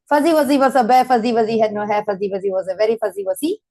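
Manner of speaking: speeding up until the words tumble out fast; as fast as possible. Shown as a demonstration. Teacher shows the class how to read it fast, rushing. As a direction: rattle it off as a tongue twister. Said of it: "So, as fast means".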